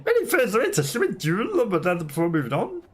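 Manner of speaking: high-pitched, formal game character; courteous duelist character. Acted. Character asks a weakened sister about another duel, courteous high-pitched role voice. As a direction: voice a polite, high-voiced challenger.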